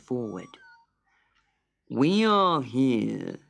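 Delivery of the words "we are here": very slow, measured pelican voice; a pompous bird opening court; solemn pelican. A narrator's reading. Pelican announces the trial to Dot, slow and solemn. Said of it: "he said".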